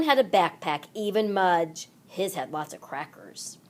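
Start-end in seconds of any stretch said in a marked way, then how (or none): 2.1-3.6 soft conspiratorial whisper; whispered aside. A narrator's reading. Narrator telling listeners a secret, whispered.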